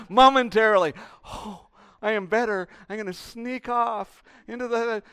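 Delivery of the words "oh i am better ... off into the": lightly acted relieved woman, higher pitched; a higher voice, trying to slip off unnoticed; woman sneaking away. Healed woman to herself as she slips away, lightly acted relief.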